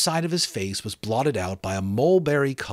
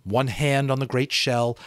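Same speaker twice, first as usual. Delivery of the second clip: ordinary delivery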